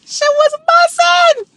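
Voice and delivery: very high, slow squeal of bussin'; giddy high. Real, not acted. Creator answering for Jimmy, giddy and very high. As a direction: squeal the verdict.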